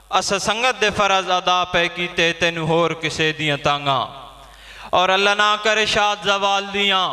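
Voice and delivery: high, sustained verse declamation; poem recitation. Acted. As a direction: recite it to the whole gathering. Poet recites verse at gathering.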